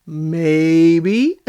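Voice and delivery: drawn-out, teasing "maybe"; coy evasion. Retold or imitated. Mike dodging Megan's question, coy. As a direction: play it coy, imitating someone who knows.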